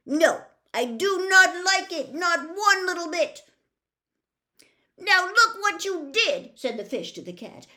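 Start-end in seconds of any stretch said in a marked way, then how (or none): 0.1-3.4 high, loud, fussy fish; upset fish voice. Acted. Fish character voice. 5.0-6.5 high, loud, fussy fish; upset fish voice. Acted. Fish scolding cat.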